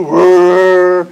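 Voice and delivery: Daniel imitating a plane; airplane whirr. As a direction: drone it like a plane. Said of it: "Daniel said".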